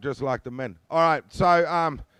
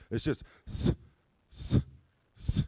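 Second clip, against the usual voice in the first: small soft unvoiced hiss; a quiet steady snake hiss; 4 semitones lower, 5 dB quieter than usual. Shown as a demonstration. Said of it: "It sounds like this".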